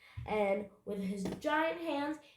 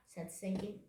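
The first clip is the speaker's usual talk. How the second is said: plain reading voice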